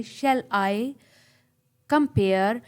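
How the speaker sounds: light "Shall", weight on "I"; "compare" split off, pushed on its second syllable; iambic stress. Shown as a demonstration. Teacher shows students where stress falls, stressing "I" and "-pare". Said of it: "बोलते हुए आप इस तरह बोलेंगे".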